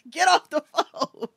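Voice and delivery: high, drawn-out mock holler; screaming mother. Mother ordering kid off the phone, mock screaming. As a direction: wail it slowly like a fed-up mom. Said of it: "where your mother screams at you".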